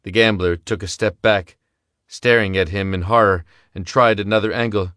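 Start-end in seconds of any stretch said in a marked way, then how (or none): none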